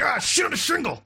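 high-pitched frustrated gamer outburst; angry yelling. Acted. Gamer re-enacts raging at Sekiro to co-host, yelling high. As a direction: yell it like a gamer who keeps dying. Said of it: "I've been consistently yelling it".

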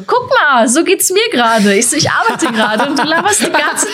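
high, pointed mock scolding of the chatter. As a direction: play the stand-up calling out the talkers, half-laughing. Retells scolding the chatting audience.

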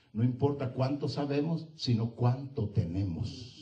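usual speaking voice

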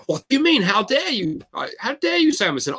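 high-pitched mock indignation; imitated outrage at an accusation; irate. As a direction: play the offended man, voice shooting up. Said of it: "would get really irate and go".

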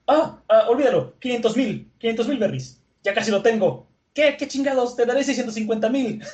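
high, eager bidding; frantic haggling. Retold or imitated. Vendor desperate to buy the sword, frantically excited.

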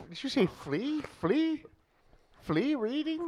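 "free" said as "flea", with an L; mocking mispronunciation. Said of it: "Why can't you say the word reading?".

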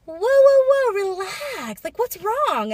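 lightly voiced staffer, whoa relax; startled cast member. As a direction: play the clueless staffer. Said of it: "the cast member's like".

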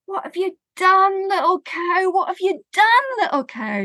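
put-on old woman astonished at the herd; dismayed old woman. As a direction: gasp at what the cow has done.

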